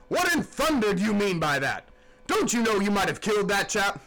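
angry captain. A narrator's reading. Gaunt captain scolding Queequeg, angry loud voice. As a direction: tear into him like a furious skipper.

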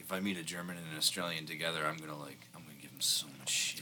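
mocking the old man's imagined plot, whispered; scheming whisper. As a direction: whisper it like a sly plan. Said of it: "planning it out".

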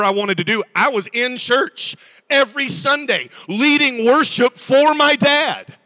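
loud, driving preacher cadence; full-force preaching. Real, not acted. Preacher declaring his freedom to the congregation, loud and driving. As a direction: preach it out to the back row.

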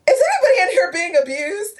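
put-on asking the kids with a catch of tears, pitched high; voice breaking. Asking a room of children if they're abused, voice breaking. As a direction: let the question crack as tears come.

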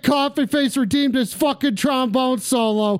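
high-pitched cursing tirade; angry outburst. Real, not acted. Host cursing ghouls and callers, angry outburst.

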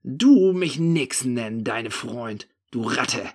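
slow, loud opening bark. A narrator's reading. Narrator rebuffing the thief's friendliness, barking. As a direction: bark the insult back at him. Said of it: "belle ich ihn an".

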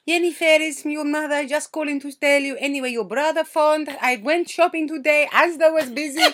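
a hint of a fussy mother; imitating mum. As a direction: play mum chattering into voicemail. Mum's rambling voicemail retold.

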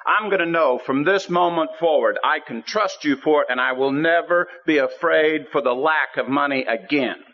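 high-pitched, emphatic vow to God; resolute impassioned prayer. Retold or imitated. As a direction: lay down terms to the Lord with fervour.

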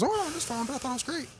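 mimicking dad's high cartoon-mouse voice; Minnie Mouse voice. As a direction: squeak it out like a tiny cartoon mouse. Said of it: "My dad talks like Minnie Mouse".

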